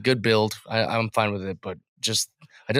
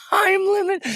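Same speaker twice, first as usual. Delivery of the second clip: very high laughing disbelief; incredulous. Real, not acted. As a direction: scoff at the idea.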